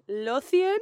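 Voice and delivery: Lothian rising high as an unsure question; mimicking a friend's uncertain pronunciation. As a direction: ask Lothian back with a puzzled lift. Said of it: "you were like".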